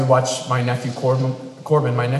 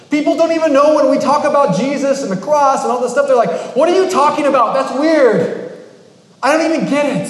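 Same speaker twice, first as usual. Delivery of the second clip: raised mock bafflement; 9 semitones higher, 5 dB louder than usual. Mocking outsiders' reaction.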